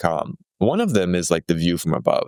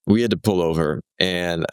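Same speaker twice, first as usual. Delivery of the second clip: ordinary delivery, nothing marked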